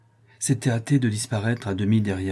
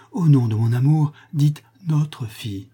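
high, urgent entreaty slowing at the end; implore, drawing out the final words; emotional pleading; 5 semitones higher, 4 dB louder than usual. A narrator's reading. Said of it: "d'une voix émue et suppliante". (supplication émue, pressante)

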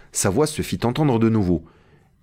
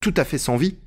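higher-pitched, lightly voiced character reply; Jupiter, emphatic servant; 6 semitones higher than usual. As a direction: confirm it point by point, pitched up. Narrator voices Jupiter answering. (Jupiter, voix légèrement jouée, plus aiguë)

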